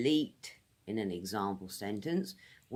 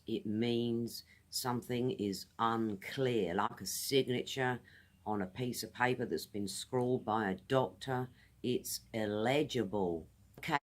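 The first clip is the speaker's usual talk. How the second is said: ordinary delivery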